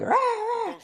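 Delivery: high-pitched mock ranting noise; upset ranting. Preacher mimicking somebody who's upset, mock ranting. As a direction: imitate someone upset with high wordless squawks. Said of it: "somebody who's upset and they're like".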